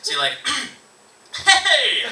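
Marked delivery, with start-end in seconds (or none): 1.5-2.1 high, loud, stretched greeting; hammed-up wide-awake phone hello; over-exaggerated awake voice. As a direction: fake alertness to hide having slept. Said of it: "over-exaggerate all of your, your words".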